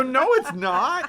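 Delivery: high, slow indignant no; hammed-up denial; mock protest. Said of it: "And we go".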